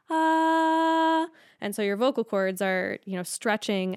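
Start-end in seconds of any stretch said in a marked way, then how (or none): 0.1-1.3 a high sung ah, much higher; high note. Shown as a demonstration. Voice coach demonstrating a high note. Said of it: "than when you're in a high note".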